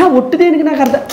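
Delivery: high-pitched, exasperated objection; indignant refusal. Real, not acted. Boyfriend balks at swearing an oath, indignant.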